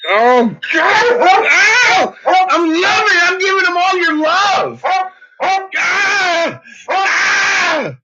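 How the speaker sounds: overjoyed cries while cuddling the dog; squealing delight. Real, not acted.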